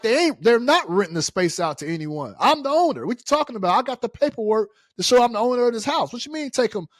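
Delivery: loud, high, fast protest that he owns the house; angry retort. Retold or imitated. Owner telling the police officer he owns the house, loud and angry. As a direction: fire back at the officers, pitch climbing. Arguing with police over squatters.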